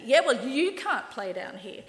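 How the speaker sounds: hammed-up playground taunt; playground bully comeback. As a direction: throw it back like a school bully. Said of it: "That's how playground bullies talk".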